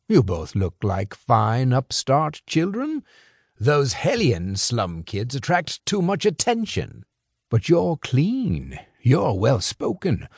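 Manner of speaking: put-on a sly older fixer, amused and in control; smooth crime boss. Crime boss lecturing two young couriers, sly and amused. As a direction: toy with the boys like a patient boss.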